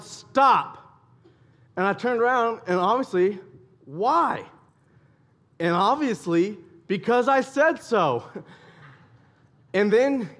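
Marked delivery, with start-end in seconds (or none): 0.0-1.0 loud, higher, one-word command; retold yell. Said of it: "he yelled". 3.9-4.4 higher-pitched questioning why; imitated child's protest. Child questions dad's shouted order. 6.9-8.2 higher, slow, emphatic parental retort; imitated stern dad. Dad's stock answer, acted.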